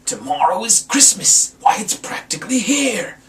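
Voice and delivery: loud, high sneering complaint; snarling Grinch. Acted. Grinch sneering down at the town, snarling loud. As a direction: spit it out with contempt. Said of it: "he snarled with a sneer".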